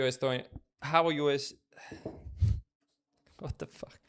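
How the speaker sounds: ordinary delivery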